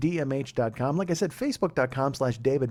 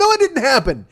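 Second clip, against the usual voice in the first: high, loud sarcastic agreement; exasperated echo; 10 semitones higher, 7 dB louder than usual. Retold or imitated. Husband echoing doubting wife, exasperated echo. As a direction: throw her words back loudly.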